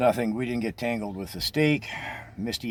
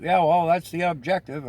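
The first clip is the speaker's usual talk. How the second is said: louder, higher, defiant retort; cursing back; 8 semitones higher, 6 dB louder than usual. Retold or imitated. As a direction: shout it back, daring her. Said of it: "I'm cursing back".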